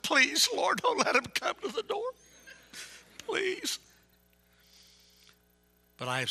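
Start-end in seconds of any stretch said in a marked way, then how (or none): 0.0-3.8 high, squeaky-pitched mock prayer; nervous pleading. New soul winner praying nobody answers the door, panicked high plea. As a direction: plead desperately, acting out dread.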